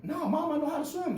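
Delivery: put-on very high childish protest; protesting kid. Kid insisting to mom he can swim, high-pitched protest. Boy protesting to his mother.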